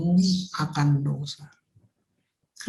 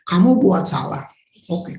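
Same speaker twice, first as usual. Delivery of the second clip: usual speaking voice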